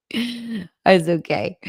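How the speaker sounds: drawn-out, tender "It's okay"; slow reassurance. Real, not acted. Slowly reassuring a viewer who cried over her comment. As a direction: stretch it out slowly to soothe.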